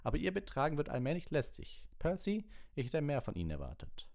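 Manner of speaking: loud, high-pitched scolding; furious professor. A narrator's reading. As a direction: tell them off angrily. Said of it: "blickte wütend in die Runde".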